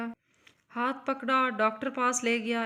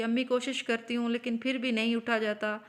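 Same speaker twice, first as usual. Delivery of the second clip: plain reading voice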